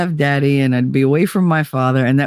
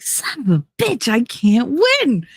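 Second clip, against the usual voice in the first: higher-pitched mock exasperation, stretching the end; exasperated; 6 semitones higher than usual. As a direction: re-enact losing an argument in frustration. Retelling her own exasperated defeat.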